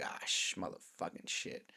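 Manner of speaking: hushed exasperated swearing; whispered curse. Retold or imitated. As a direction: swear under your breath at the price. Muttered curse at price.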